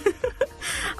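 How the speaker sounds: soft voiceless giggle; a quiet airy chuckle; breathy laugh. Real, not acted. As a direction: laugh under your breath.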